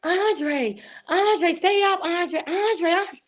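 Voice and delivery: high-pitched imitation of crying kids; crying children. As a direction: call the name high, as upset children would. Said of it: "my two kids crying like".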